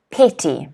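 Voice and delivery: Petty, PET stressed, falling. Shown as a demonstration. Teacher repeats Petty, first syllable stressed. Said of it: "Stress again on the first syllable".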